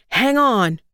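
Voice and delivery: lightly voiced shout; narrated shout. A narrator's reading. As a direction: call out over the engine, without full force.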